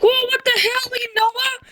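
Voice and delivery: high squeaky hammed-up disbelief; mock shock. Friends reacting to Noah's mistake, high mock shock. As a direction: act stunned and squeal it. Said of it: "And we're like".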